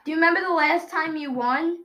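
ordinary delivery

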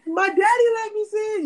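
put-on squeaky, very high kid voice; mock child. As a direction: answer back as a high-pitched little kid. Voicing a child answering back.

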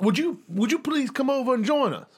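high, pleading genteel invitation; fussy old colonel, hopeful. Acted. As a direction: implore politely as an old Southern gentleman. Jiro politely invites stranger over.

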